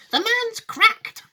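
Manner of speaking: put-on high, loud old woman, drawn-out; Grandma Josephine. Grandma judging Wonka mad, high, loud and slow. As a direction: declare it in an outraged granny screech. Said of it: "said Grandma Josephine".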